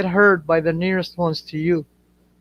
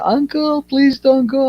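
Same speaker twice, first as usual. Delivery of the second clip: high-pitched imitation of begging kids; pleading children; 8 semitones higher than usual. Nephews and nieces begging their uncle, imitated childish plea.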